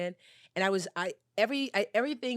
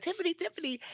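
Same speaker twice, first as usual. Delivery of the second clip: much higher, calling a name like a warning; mock warning call; 12 semitones higher, faster than usual. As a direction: sing out the name as a playful alarm. Red flags calling her name.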